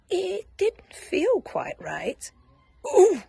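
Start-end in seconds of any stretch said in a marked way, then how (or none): 2.8-3.2 high loud strained ooh; high loud straining grunt; effortful grunt. Acted. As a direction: grunt under a heavy load. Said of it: "grunted Douglas".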